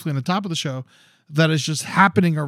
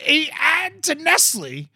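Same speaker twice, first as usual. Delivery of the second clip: outraged rant; 12 semitones higher than usual. Real, not acted. Furious at a Nespresso ad during the show, high outraged rant. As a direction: rant about it, voice way up.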